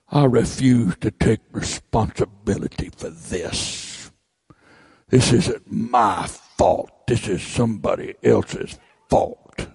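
mock the one who blames everyone; whiny self-pitying congregant. Voicing a stubborn congregant.

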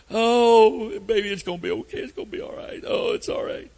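high cooing comfort, fading to a hush; mother soothing. Retold or imitated. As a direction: coo like a mom scooping up her hurt boy. Mother comforting hurt little boy.

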